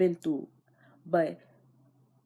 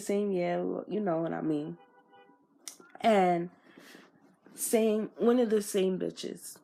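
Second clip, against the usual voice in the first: ordinary talk, nothing marked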